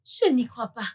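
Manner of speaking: quiet broken no, no, no, close to sobbing; tearful pleading. Acted. Accused friend begging her not to do this, tearful. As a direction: beg in despair, voice breaking.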